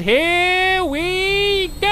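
high, slow gleeful here we go; excited drawn-out. Real, not acted. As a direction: stretch it out like a ride starting. Teleporter launch.

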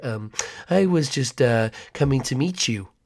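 creepy neighbor. Acted. Creepy neighbor introduces himself.

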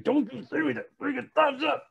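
high, exasperated outburst; hammed-up indignant; mock anger. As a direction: scold them for the thumbs up. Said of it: "I'm like".

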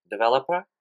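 normal talking voice

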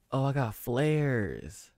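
normal talking voice

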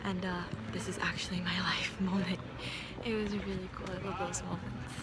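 whispering. Real, not acted. Quiet night walk reflection.

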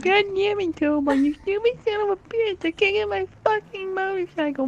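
put-on squeaky child voice howling about his motorcycle; whiny kid. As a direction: play a three-year-old throwing a tantrum. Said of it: "He's like".

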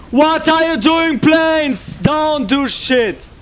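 exasperated shout at the screen; angry yelling. Real, not acted. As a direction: yell furiously at useless units. Yelling at own units in game.

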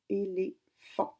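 "éléphant" lent, é-lé... phant; découpé en syllabes. Shown as a demonstration. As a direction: isoler la dernière syllabe de "éléphant". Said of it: "Donc la dernière syllabe". («éléphant» dit lentement, syllabes détachées)

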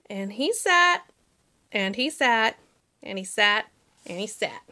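slowing repeated sat fading away; fading repetition. A narrator's reading. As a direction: let it sink step by step.

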